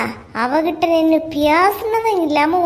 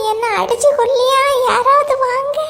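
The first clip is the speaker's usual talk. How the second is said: high, panicked cry for help; 8 semitones higher than usual. Acted. Wife being hit by her husband, crying out high for help. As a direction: shriek for someone to come save her.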